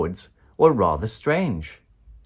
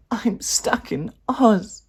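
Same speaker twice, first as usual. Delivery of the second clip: mild sob; 12 semitones higher, slower than usual. A narrator's reading. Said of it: "Dorothy sobbed".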